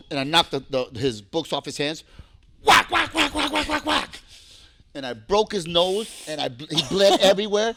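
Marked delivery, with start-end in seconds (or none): 2.6-4.2 rapid high, loud whacks; imitated punches. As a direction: mimic a flurry of blows.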